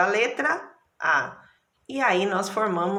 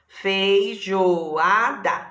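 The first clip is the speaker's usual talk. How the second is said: Feijoada said whole, drawn out very slowly; slow, stretched whole word; 4 dB louder, much slower than usual. Shown as a demonstration. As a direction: join the syllables back into one slow word. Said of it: "Consegui, olha lá".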